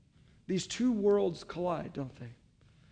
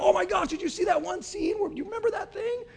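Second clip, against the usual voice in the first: excited moviegoers; 11 semitones higher than usual. Retold or imitated. Moviegoers leaving the theater, high and gushing. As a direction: gush about the movie like a fan. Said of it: "they're like".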